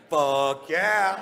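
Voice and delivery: high, loud "Fuck yeah" with a bright rise on "yeah"; enthusiastic fuck yeah. Shown as a demonstration. Comic showing how to answer a yacht invite, eager and loud. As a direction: bark out "Fuck yeah" with glee. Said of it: "You go".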